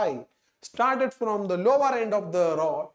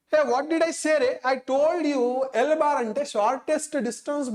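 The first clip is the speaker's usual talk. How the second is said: raised, scolding voice; 6 semitones higher than usual. Real, not acted. Teacher scolding the class.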